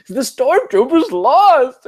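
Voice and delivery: put-on high, slow wailing little boy; child voice. Four-year-old son grieving the stormtroopers, high slow wail. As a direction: wail it like a heartbroken kid.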